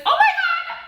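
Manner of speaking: very high, loud burst with a laugh; delighted squeal. Real, not acted. Host spotting a friend in the live chat, squealing with delight. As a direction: squeal with real joy at seeing a friend.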